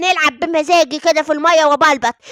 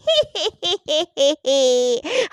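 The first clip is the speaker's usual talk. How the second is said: gloating cackle; 3 semitones lower than usual. Acted. Grandma's evil gloating laugh.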